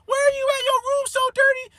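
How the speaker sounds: high, shrill-pitched scolding mother; mama going off. Retold or imitated. Mom scolding her grown son, high mock voice. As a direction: squeal it out like an angry mom. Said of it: "I felt so bad for the homie".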